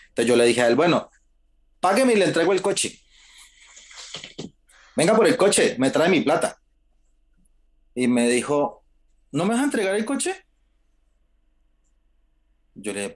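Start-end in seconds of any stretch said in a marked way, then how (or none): 0.8-6.6 higher, louder insistence, pay me first; retelling his firm demand with a raised voice. Retold or imitated. Demanding payment, retold quarrel. 9.3-10.3 much higher, incredulous question; mock indignant surprise. Boss's shocked question, retold.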